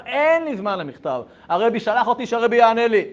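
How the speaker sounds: loud, high, insistent argument; acted impatience. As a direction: push back hard on the phone.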